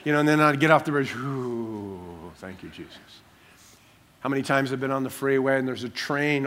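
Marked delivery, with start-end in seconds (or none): 1.1-3.2 soft, breathy-free hushed relief, much quieter; relieved sigh of thanks. Retold or imitated. Driver off the bridge thanks Jesus in hushed relief. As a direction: murmur thanks like the danger just passed.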